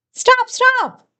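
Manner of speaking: high panicked double stop; screamed. A narrator's reading. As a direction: shriek it out in alarm, pitched high. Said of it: "my little sister screamed".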